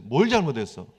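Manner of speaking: 크게 다그쳐 묻는 엄한 부모; 뭘에 힘을 주고 추궁하듯; 다그치는 부모. Retold or imitated. 아이를 다그치는 부모. (아이를 혼내며 잘못을 캐묻는 부모, 크게 다그치듯)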